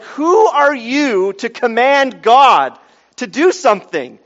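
outraged rhetorical question; indignant challenge. Acted. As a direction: challenge their presumption, slow and forceful. Rebuking presumptuous preachers.

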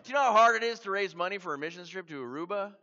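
loud, fast, high-pitched protest; comic exasperation. Retold or imitated. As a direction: complain in disbelief at an absurd task. Protesting fundraising for island trip.

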